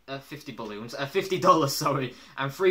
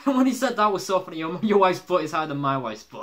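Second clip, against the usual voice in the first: laughing into a raised, quick retelling; amused; 4 semitones higher, 7 dB louder than usual. Real, not acted. Retelling a favourite cartoon line, amused and laughing. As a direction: crack up while recalling the joke.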